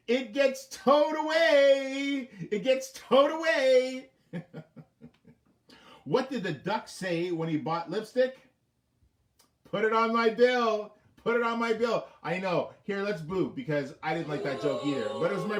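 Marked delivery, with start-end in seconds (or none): 0.1-4.1 slow, high, loud, stretched delivery; milk the punchline, stretching it out; big hammed punchline; drawn-out punchline. 9.6-12.1 lightly voiced duck; duck punchline.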